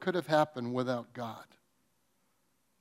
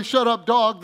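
retold yell; 6 semitones higher, 7 dB louder than usual.

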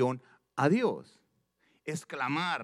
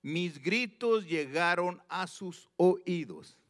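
"gritos" high and slow, each word weighted; emphatic reading; 5 semitones higher, slower than usual. Acted. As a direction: pitch up and slow down on "Mis gritos". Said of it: "fíjate, esta parte dice". (lectura enfática, alta y lenta)

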